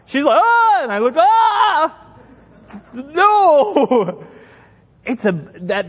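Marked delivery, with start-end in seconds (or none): 0.4-0.8 high mock shriek of fright; terrified scream. Father imitating his daughter seeing her bloody nose, terrified shriek. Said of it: "terrified by this". 1.2-4.3 high, hammed-up horrified yell; shriek, then draw out a horrified no; terrified scream. Father reliving the sight of his daughter's nosebleed, mock terror. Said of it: "And I was terrified".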